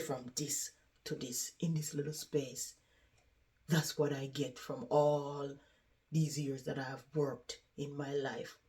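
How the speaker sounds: very soft, resigned voice; subdued lament. Retold or imitated. Resident lamenting what years of work got her, subdued. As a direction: sink into a quiet, weary retelling.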